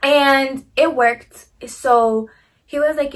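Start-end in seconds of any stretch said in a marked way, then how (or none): none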